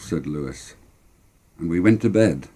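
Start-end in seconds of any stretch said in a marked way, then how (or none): none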